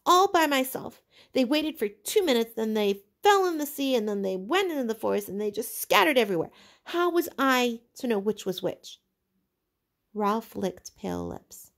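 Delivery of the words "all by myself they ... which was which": high, loud indignant protest; Piggy protesting the blame; indignant cry. A narrator's reading. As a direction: fling the question back at him.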